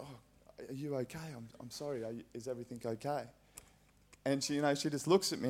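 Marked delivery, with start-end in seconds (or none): 0.0-3.2 softer, hushed and anxious; gentle concern. Retold or imitated. Teacher checking on crying student, hushed concern. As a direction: ask softly if she's alright.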